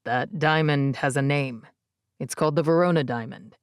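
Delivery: low, steady man's voice; male character voice. A narrator's reading. Easton voiced by narrator.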